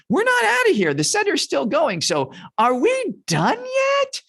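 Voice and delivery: very high, whiny complaint; hammed-up squeaky griping; kvetching. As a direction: whine like an impatient child at a long meal. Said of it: "is to start fetching".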